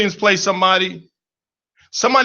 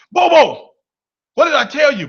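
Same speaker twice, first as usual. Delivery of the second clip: higher, louder stern grandma warning; 7 semitones higher, 5 dB louder than usual. Retold or imitated. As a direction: scold like a grandma. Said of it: "And she says".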